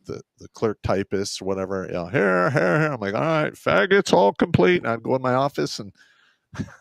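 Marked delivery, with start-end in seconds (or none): 2.1-2.9 higher mock sound-off; lightly voiced clerks sounding off; imitated roll-call answers. 3.1-4.8 raised, higher sergeant's announcement; loud imitated inventory call; retold sergeant's call. Retelling his loud inventory call.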